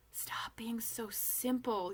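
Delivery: slow, breathless whispered scolding; scornful whisper. Acted. Kite whispers a scolding to Ophelia.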